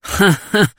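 short acted rumbling chuckle; rumbling student chuckle; rumbling laugh. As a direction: laugh it off in a brief burst. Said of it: "wydobył się dudniący śmiech".

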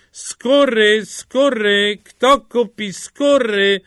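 high, loud hawking call; long-drawn peddler's shout; street vendor's cry. A narrator's reading. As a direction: keep calling your wares, drawing out the words. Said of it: "wołał dalej".

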